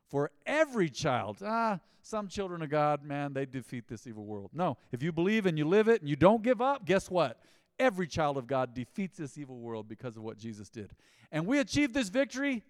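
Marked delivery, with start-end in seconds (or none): 4.5-12.6 loud, rising preacher's call; preaching. Acted. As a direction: drive it home to the congregation. Preaching at full force.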